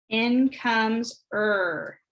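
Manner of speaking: "er" ditarik sangat pelan dan panjang; stretched sound. Shown as a demonstration. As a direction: slowly draw out the "er". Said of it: "Out goes k".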